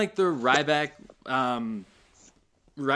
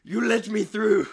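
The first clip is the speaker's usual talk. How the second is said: bad Russian accent; 9 semitones higher, 7 dB louder than usual. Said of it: "He just sounds like Russian. Really bad Russian".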